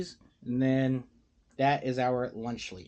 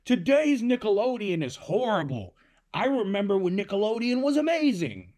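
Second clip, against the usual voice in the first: mock whiny nostalgic complainer; 7 semitones higher than usual. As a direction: whine it like a jaded adult fan. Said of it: "saying, you know".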